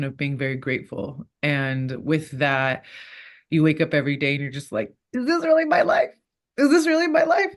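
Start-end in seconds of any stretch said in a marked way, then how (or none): 5.1-7.5 high, loud, wide-eyed squeal of amazement; pinch-yourself wonder, pitched way up; giddy disbelief. Acted. Fighter waking up amazed at her movie life, giddy disbelief.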